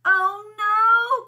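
high, loud wail of dismay; crying out. A narrator's reading. Buzz hears every fly answer him, crying out in dismay. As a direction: cry it out, stretched and dismayed. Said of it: "cried Buzz".

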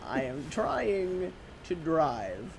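slow exasperated. Acted.

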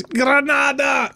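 high, drawn-out mimicked cry; imitated game shout. Commentator recalling an endlessly repeated game line, mock shout. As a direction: call it out high, stretching the word. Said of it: "it was always".

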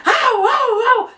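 high-pitched mock cries; short, high "wow" wails; imitated wailing. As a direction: mimic the commotion in high yelps. Imitates noisy screams from the housemates.

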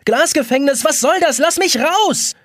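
high, indignant full shout. A narrator's reading. Jonas shouting at his glass prison, high demanding yell. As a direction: yell to be let out. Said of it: "ruft Jonas".

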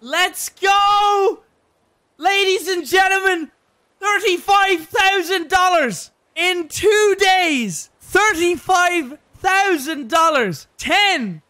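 huge, high triumphant yelling; ecstatic shout. Real, not acted. Streamer celebrates hitting the donation goal, screaming with joy. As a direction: roar it out like you just hit the goal.

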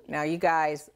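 ordinary delivery

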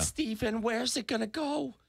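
put-on high, slow old lady voice. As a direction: play a doting grandma, slowly. S2 voices the mom.

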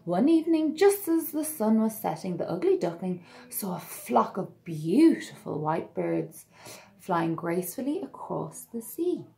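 put-on lively read-aloud to children; storybook narration. A narrator's reading. Narrator telling children the tale, bright and lively. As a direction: read it like bedtime story time.

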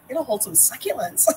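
half-laughing through the line; chuckling as she answers her husband. Retold or imitated. Laughing while telling husband the plan. Retelling own laughing reply.

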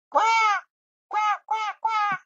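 quack, quack, quack in a high squeaky bird voice. Pretend. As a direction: squawk like a cartoon bird.

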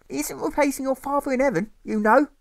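high, anxious mother's voice; mother with dread. Acted. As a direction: plead with the boy, full of dread. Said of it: "she says with dread".

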